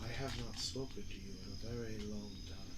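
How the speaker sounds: a hint of a deep godlike voice. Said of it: "very deep voice call out to you and go".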